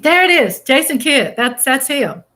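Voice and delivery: thrilled to hear the name; bursting with excitement; excited. Real, not acted. Recognizing the player named.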